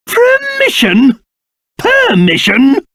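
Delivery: slow, high-pitched affronted echo; indignant disbelief. Acted. King challenged on his own bridge, haughtily indignant. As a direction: draw the word out, scandalised.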